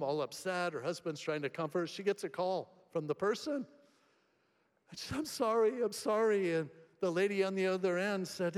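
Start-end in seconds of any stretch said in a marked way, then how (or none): 5.2-6.5 higher, pleading apology; anxious apology. Retold or imitated. Courtney apologising to the car owner, flustered and higher. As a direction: blurt the sorry, flustered and higher.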